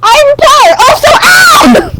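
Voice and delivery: high, wailing panicked shout; loud screaming. Acted. Player reacting as the statue moves, hammed-up high scream. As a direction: shriek it in comic alarm. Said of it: "loud screaming because it moved".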